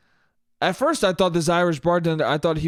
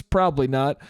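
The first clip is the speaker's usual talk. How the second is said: normal talking voice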